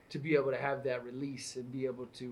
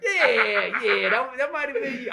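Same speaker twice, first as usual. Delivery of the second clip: clipping distorted mic; 9 semitones higher, 10 dB louder than usual. Real, not acted. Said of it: "I gotta stop yelling in the mic".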